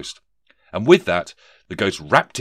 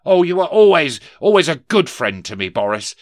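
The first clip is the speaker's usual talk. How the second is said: high, loud, effusive thanks; gushing Scrooge; 8 semitones higher, 8 dB louder than usual. Acted. Scrooge flatters his old partner, high and gushing. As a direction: open high and loud, then ease down.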